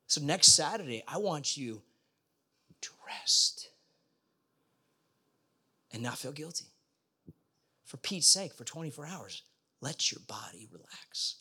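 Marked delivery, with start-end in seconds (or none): 2.8-6.7 breathy hushed "rest"; whispered. Acted. Pastor whispers rest invitation. 9.8-11.4 hushed, low whisper; soft whisper, easing the body down. Acted. Pastor whispers relaxation cue.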